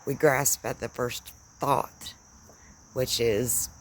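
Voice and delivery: usual speaking voice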